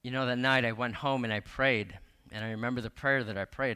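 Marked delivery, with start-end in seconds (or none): none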